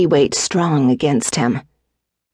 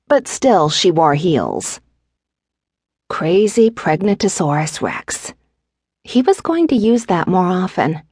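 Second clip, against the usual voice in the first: usual speaking voice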